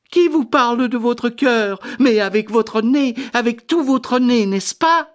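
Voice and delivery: high, insistent correction; impatient duchess. Acted. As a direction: set him straight in a very high voice. La duchesse le reprend avec insistance. (duchesse impatiente, voix suraiguë)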